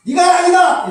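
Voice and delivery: shouted high and loud, the wrong way; loud declaration. Shown as a demonstration. Abraham blurts the news to Isaac, a loud shout. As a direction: bellow it out like a proclamation. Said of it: "이렇게 얘기했어요?".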